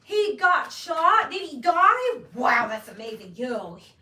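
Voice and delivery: high, loud, drawn-out amazed gush; mock-thrilled suburban gush over a shooting story; valley girl gush. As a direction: gush like a sheltered suburban girl hearing gossip. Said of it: "I'm talking like that".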